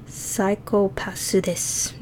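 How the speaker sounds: slow, soft mock Japanese accent. Shown as a demonstration. Trying out the translated phrase, quiet Japanese accent. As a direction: sound it out slowly with a Japanese accent. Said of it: "saying it in a Japanese accent".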